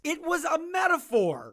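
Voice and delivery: high, protesting outburst; exasperated shout. Acted. Doctor yelling at the warden, exasperated outburst. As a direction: cry out in frustration.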